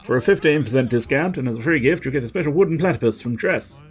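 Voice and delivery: high eager salesman. Acted. Voiced villager Corey pitching.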